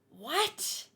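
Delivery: normal talking voice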